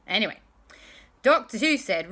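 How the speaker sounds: ordinary delivery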